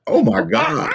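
high, loud shocked cry; shocked shout. Real, not acted. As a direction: yelp it out in shocked disbelief. Shocked by Fatal Attraction joke.

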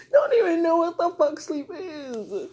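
high, loud and drawn out; exasperated, stretched-out outburst; incredulous exclamation. Real, not acted. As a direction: cry it out slowly in amused disbelief. Repeats the point in loud disbelief.

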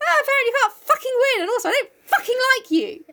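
high, exasperated outburst. Retold or imitated. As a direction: vent in a fast, fed-up rant. Exasperated rant as Meg.

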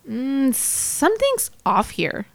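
drawn-out pondering; thinking to oneself. A narrator's reading. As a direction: puzzle it over privately. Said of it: "I thought to myself".

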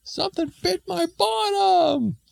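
high, drawn-out comic yelp; hammed-up scream. Man bitten on the bottom, mock scream. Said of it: "the man screamed".

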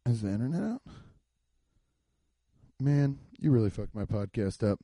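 normal talking voice

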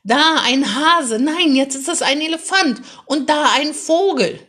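put-on a boy in the car rowdily calling out animals in the clouds; exuberant bawling. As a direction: bawl out the cloud shapes with glee. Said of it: "Maximilian gröhlte übermütig".

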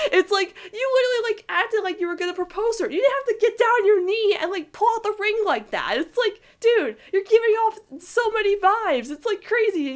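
high and squealy, gushing over the scene; excited gushing. Real, not acted. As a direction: fangirl squealing about the ship. Gushing fan reaction to proposal-like trick.

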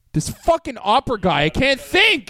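exasperated outburst rising high on the end; fed up, pitch jumping at the finish. Real, not acted. Podcaster complaining about the opera guy, exasperated. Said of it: "This guy's going nuts".

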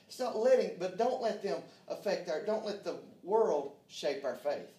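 loud, high-pitched exhortation to the congregation; full-force preaching. Real, not acted. Preacher warning the church about the world, loud, fervent preaching. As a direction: preach it hard, hitting key words.

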